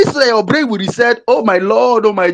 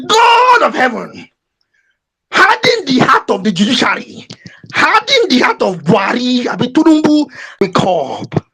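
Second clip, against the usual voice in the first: mock prayer. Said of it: "I say".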